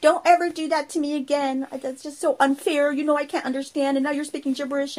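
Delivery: hurt scolding mom. Retold or imitated. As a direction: scold the kid who tricked her. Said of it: "She's just like".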